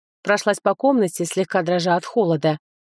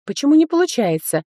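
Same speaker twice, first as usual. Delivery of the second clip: alarmed; 7 semitones higher, 3 dB louder than usual. Acted. Heroine panics, magic fails.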